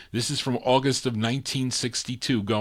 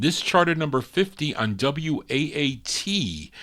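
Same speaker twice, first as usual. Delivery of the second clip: usual speaking voice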